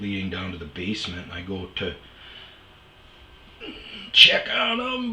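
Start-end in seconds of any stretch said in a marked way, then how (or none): 4.1-5.1 loud. Real, not acted. Going to confront roommate.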